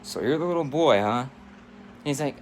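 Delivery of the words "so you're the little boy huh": put-on father voice, loud; sizing-up dad. Father meeting his daughter's friend at the door, challenging. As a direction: look the kid over with a challenge. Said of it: "the dad opens the door like".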